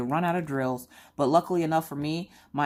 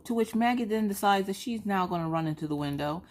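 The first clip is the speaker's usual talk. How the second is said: ordinary delivery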